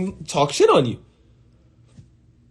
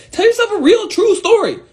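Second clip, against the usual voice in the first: emphatic high push. Real, not acted. Coaching viewers to face embarrassment, high and urgent. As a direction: voice jumps up high to insist. Urging listener.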